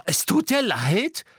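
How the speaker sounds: high, slow incredulous echo; shrill-pitched scornful question; outraged disbelief. A narrator's reading. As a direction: throw the apology back at him. Hermine incredulous at Ron's apology.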